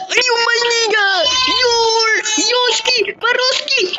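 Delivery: high, gasping, half-laughing shriek; panicked shriek. Real, not acted. Gamer to a teammate, a high panicked laughing shriek. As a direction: squeal it out in a frantic panic.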